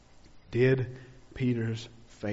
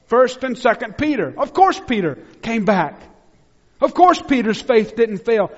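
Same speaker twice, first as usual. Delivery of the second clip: loud, high, ringing pulpit voice; full-force preaching; 11 semitones higher, 12 dB louder than usual. Acted. Pastor preaching hard to his church, loud and high. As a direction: preach it out with fire to the congregation.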